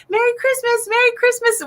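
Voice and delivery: high, bright holiday greeting call; merry shopkeeper farewell, called high; cheery calling out. Retold or imitated. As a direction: sing out a festive goodbye to customers. Waving off customers at fair.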